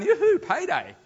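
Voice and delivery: high, loud gleeful whoop; gleeful cheer. Retold or imitated. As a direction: cheer like you just got paid. Gleeful reaction to being repaid.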